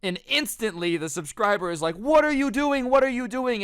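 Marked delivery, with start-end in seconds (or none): 1.9-3.6 high, loud alarmed retelling; imitating a frantic, repeated shout; panicked shout. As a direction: cry out in disbelief, pitched way up. Panicked subscriber catches brother.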